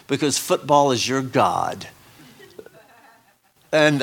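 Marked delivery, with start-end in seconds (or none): 0.1-1.9 slow, lightly imitated preacher's rebuke; George Francis. Missionary scolding the congregation, lightly imitated slow rebuke. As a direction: lightly mimic a blunt visiting missionary. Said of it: "That's Brother George".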